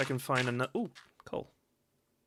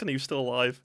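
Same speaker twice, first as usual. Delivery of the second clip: ordinary talk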